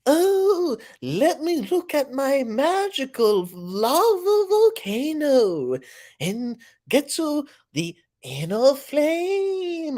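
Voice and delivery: high, slow airy candle flame voice; flickering warm candle voice. Pretend. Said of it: "Silver trying to do a candle impression".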